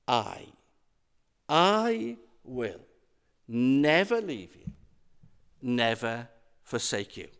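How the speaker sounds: weighty pauses after each "I" and before each "never"; stress on I. Acted. Preacher sets God against those who leave, stressed I. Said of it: "The emphasis is on the word".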